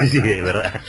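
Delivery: jittery laughter through the words; nervous laugh. Real, not acted. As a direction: laugh nervously, can't hold it in. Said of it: "me río de los nervios".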